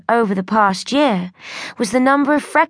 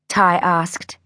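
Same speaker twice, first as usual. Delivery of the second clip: plain reading voice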